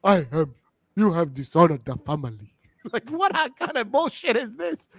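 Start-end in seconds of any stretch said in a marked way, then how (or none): 0.0-2.5 lower, mock-grave put-on accent; Kofi's mom. Mother disowns her wrestler son, grave mock accent. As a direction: pronounce a family disgrace in her accent. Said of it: "I need to keep doing her accent".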